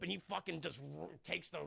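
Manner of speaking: usual speaking voice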